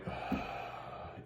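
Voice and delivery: long, soft, unvoiced sigh; exasperated sigh. Supporters reacting to a stubborn king with a long sigh. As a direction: let out the air like you're fed up. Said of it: "his supporters are kinda like".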